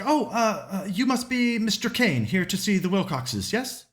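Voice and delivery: a lightly voiced eager housemaid at the door; maid's voice. Maid welcoming the detective at the door, high and eager. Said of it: "And she's like".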